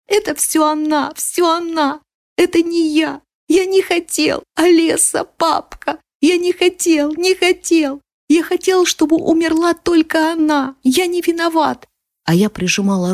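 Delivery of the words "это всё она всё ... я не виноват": put-on high, frantic child's cries; frenzied sobbing boy. A narrator's reading. Boy clutching her dress, crying out frantically through sobs. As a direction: cry out high in short bursts of anguish. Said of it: "иступлённо выкрикивал сквозь рыдания".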